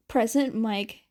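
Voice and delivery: ordinary delivery, nothing marked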